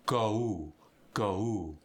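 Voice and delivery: "Go" gliding from oh into oo; exaggerated diphthong. Shown as a demonstration. Teacher proving a point, exaggerated glide in "Go". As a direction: draw out both sounds of the vowel in "Go". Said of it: "I E. I'm slightly exaggerating".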